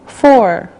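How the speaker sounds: ordinary talk, nothing marked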